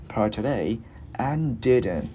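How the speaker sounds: very slow, word groups held apart; slow demonstration. Shown as a demonstration. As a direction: stretch each phrase for learners. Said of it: "mình cứ đi từ từ". (đọc thật chậm, tách từng cụm)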